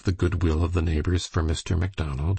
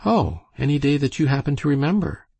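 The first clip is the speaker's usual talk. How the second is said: bright, higher-pitched quick reply; 9 semitones higher, faster than usual. A narrator's reading. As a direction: answer lightly and breezily, pitch up. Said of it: "answered Aleck brightly".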